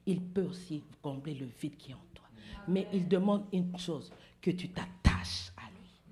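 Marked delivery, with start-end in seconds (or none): 0.1-2.2 soft, breathy assuring whisper. Acted. Preacher tells a searching listener God fills the void, whispered. 4.4-6.0 whisper. Acted. Preacher tells a searching listener God fills the void, whispered.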